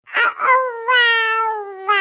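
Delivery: drawn-out wail for mum; crying for mother. Acted. Child calling after mother leaving for work, crying. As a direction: wail the word, stretching it out.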